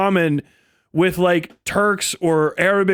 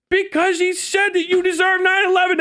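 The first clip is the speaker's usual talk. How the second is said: ordinary talk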